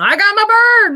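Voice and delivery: high and loud, proudly announcing; proud spirit voice. Retold or imitated. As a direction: boast about your bird. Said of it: "he's leaning on".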